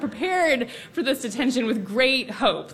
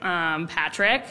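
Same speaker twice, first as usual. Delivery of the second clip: knowing suggestion; 6 semitones lower, slower than usual. Retold or imitated. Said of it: "raised her eyes at me and was like".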